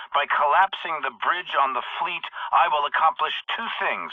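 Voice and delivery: usual speaking voice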